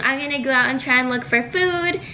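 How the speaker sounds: normal talking voice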